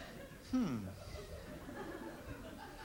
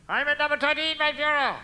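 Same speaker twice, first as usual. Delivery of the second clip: mock Rommel; 8 semitones higher than usual. Rommel answers his Führer.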